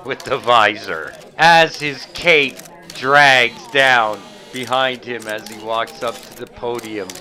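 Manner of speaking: live news-style commentary; reporter play-by-play. Acted. Reporter describing the villain's entrance, live. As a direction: describe him like breaking news.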